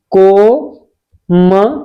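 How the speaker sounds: Komal very slowly, as "ko... ma... la" with long pauses; syllable by syllable. Shown as a demonstration. Teacher spelling out for students, very slow broken-up Komal. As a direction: draw out each syllable of Komal separately.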